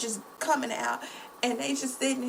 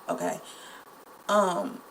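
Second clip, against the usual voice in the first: ordinary talk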